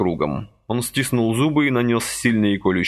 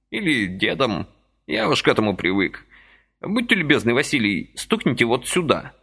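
put-on high, chirpy old man's voice; lively old man pressing a deal; brisk old man; 6 semitones higher than usual. A narrator's reading. Lively old man persuading Vasily, high chirpy voice.

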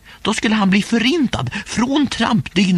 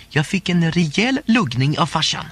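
usual speaking voice

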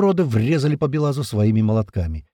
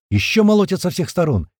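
plain reading voice

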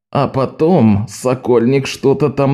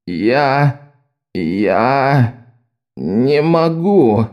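struggling; much slower than usual. A narrator's reading. Bewitched countess struggles to speak.

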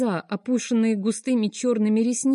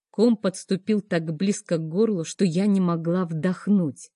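usual speaking voice

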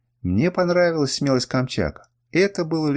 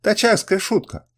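high-pitched repeated exclamation; crying out; 9 semitones higher than usual. A narrator's reading. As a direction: exclaim it much higher than usual. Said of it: "выкрикнул Сафрар". (высокий взволнованный выкрик)

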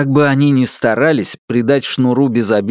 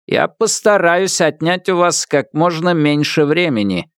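synthesized voice; 6 semitones higher than usual. Acted. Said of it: "синтезированный голос".

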